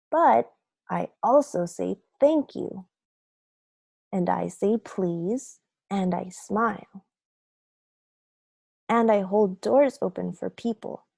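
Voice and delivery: plain reading voice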